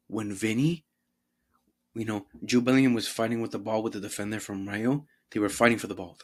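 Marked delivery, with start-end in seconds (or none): none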